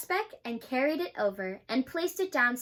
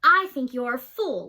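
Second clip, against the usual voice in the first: laughing sneer; 9 dB louder than usual. A narrator's reading. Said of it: "laughed the sour kangaroo".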